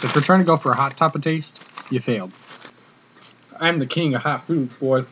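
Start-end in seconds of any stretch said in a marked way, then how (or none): none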